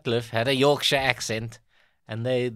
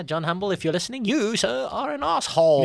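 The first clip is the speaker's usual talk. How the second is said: high, loud, pompous address; mock formal denunciation; 9 semitones higher, 5 dB louder than usual. Addressing the hoaxer in a hammed-up high voice. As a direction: denounce him like a grand orator.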